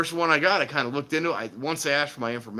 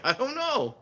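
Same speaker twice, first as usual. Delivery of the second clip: high clueless reply; old guy in commercial; 12 semitones higher, slower than usual. Retold or imitated. Retelling husband's clueless answer, high. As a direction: shrug it out cluelessly. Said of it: "He's like".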